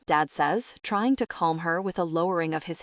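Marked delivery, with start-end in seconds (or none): none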